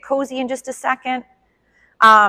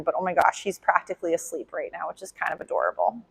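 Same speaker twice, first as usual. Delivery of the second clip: soft, low cooing at a dozing puppy; 4 semitones lower, 7 dB quieter than usual. Real, not acted. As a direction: hush it, the dog is asleep.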